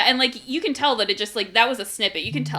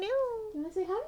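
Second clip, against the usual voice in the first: high soft cooing voice; pet talk; 12 semitones higher, 8 dB quieter than usual. Real, not acted. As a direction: softly coo at the cat. Talking to the cat.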